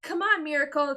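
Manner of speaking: Tukey calling Miracle up. Acted. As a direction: call to her, reaching out. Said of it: "Tukey called".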